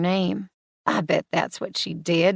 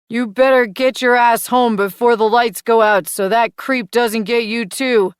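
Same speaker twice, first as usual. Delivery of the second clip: loud, higher copy of a stern dad warning; echoing her daddy voice; 4 semitones higher, 8 dB louder than usual. Acted. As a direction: parrot the dad scolding exactly. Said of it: "Mason echoes, sounding just like me".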